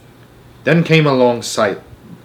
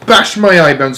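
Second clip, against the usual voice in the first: louder, higher outburst; distressed cry; 5 semitones higher, 6 dB louder than usual. A narrator's reading. Giant curses over the bad dream, loud and distressed. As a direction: curse in despair. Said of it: "he cried".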